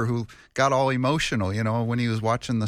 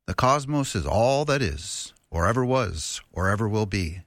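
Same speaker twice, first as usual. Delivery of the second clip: ordinary delivery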